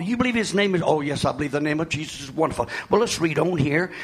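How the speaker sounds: ordinary talk, nothing marked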